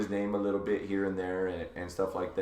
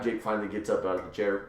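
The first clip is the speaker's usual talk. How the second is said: ordinary talk, nothing marked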